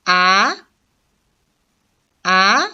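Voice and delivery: "a" sweeping upward; second, rising tone. Shown as a demonstration. Teacher reads syllables for learners, rising "a". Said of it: "attention to the tones".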